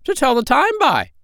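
very high, squawky parrot voice; squawking parrot voice. Acted. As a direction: squawk it out like a bossy old parrot. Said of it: "said the parrot".

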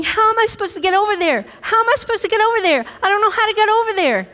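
high, fast, worried babbling; fretful panic. Retold or imitated. A worrier fretting about getting there, frantic. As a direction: spiral in anxious questions. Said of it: "over here going".

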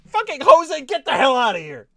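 high, loud hammed-up rant at Jose; mock furious shout. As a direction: bellow him out of the room in fake fury. Mock rage at rival manager.

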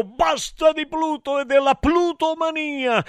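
theatrical high-voiced protest; mock protest cry. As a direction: join the outcry in a high voice. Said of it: "Gridai pure io".

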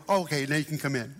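sheepish disciples. Acted. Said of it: "They go".

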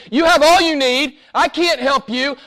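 high, forceful and driving; preacher insisting the church lacks nothing; insistent preaching. Real, not acted. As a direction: hammer it home, you have all you need. Preacher insists, repeated refrain.

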